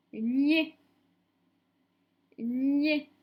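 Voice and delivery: loud, drawn-out dictated syllable; dictation voice. Shown as a demonstration. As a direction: dictate it firmly to a class, stretching the syllable. Teacher dictating syllables to pupils.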